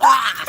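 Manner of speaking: shrieking laugh; high scream into laughter; tripping and falling. Real, not acted. Stumbling in, laughing.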